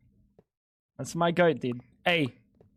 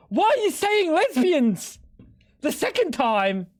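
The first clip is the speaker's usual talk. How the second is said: high, drawn-out disbelief; baffled, pitch jumping way up; incredulous; 8 semitones higher than usual. Real, not acted. Baffled reaction to a remark.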